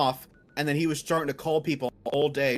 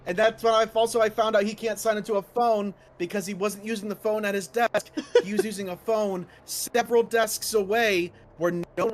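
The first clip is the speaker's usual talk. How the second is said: high-pitched angry venting; real heated complaint; pissed off; 7 semitones higher than usual. Real, not acted. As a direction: keep ranting with the voice held up high. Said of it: "I'm pissed".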